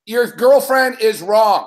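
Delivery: each word hammered slowly; slow emphatic. Real, not acted. Host telling Mick his girlfriend is wrong, slow hammered stress. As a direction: spell it out word by word.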